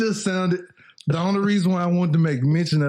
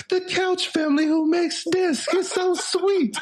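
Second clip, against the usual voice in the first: much higher, sugary anchor read, lightly imitated; sweet news anchor; 12 semitones higher than usual. Retelling the news report to his cohost, in a higher mock anchor voice. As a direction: gush it like a morning news host. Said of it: "they was like".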